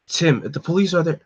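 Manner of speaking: ordinary delivery, nothing marked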